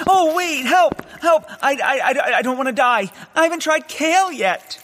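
mock panic. Tied-up hero pretending to panic at death, hammed-up. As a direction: squeal for help like a cartoon victim.

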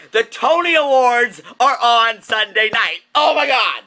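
high, loud, squealing gush; gushing excitement. Real, not acted. Fan gushing to viewers about the Tony Awards, high and loud. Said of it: "I'm so excited".

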